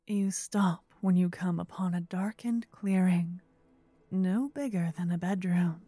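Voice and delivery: ordinary talk, nothing marked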